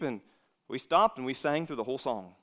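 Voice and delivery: normal talking voice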